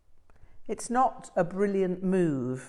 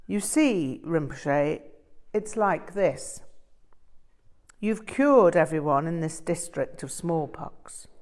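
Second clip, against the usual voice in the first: ingratiating delegation monk. Acted. Delegation pleads with dying lama.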